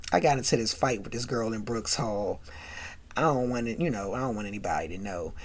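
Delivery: low, soft, evasive excuse; confiding cover story. Retold or imitated. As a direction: act out a girl keeping it hushed.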